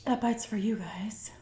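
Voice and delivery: slow breathy aside to viewers; slow whispered aside. Real, not acted. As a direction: whisper it behind his back.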